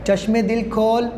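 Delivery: ordinary talk, nothing marked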